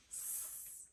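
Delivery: a voiceless hissed "s" with no vowel after it; just the hiss of "s", like air from a tire; hissed s sound. Shown as a demonstration. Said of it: "the suffix does not get scooped".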